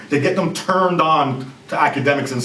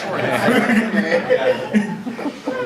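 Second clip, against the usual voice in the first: long rising laugh; 6 semitones higher than usual. Real, not acted. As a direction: let the laugh roll on. Laughs after Canada joke.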